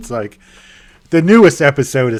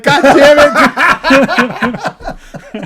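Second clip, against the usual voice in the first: higher, louder laughing curse; mock frustration; 13 semitones higher, 7 dB louder than usual. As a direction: curse the failed plan, laughing.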